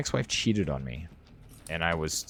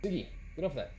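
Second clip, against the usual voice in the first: talking to the dog; 11 semitones higher, 8 dB quieter, much faster than usual. Real, not acted. Calling off the pet.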